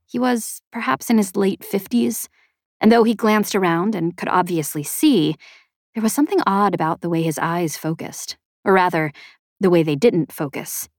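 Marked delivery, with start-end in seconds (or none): none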